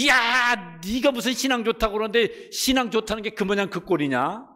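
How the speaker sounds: high, jeering scorn at his faith; sneer, mock his God; scornful taunt. Mockers deriding David's faith, high scornful jeer. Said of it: "이런 소리를 들으니까".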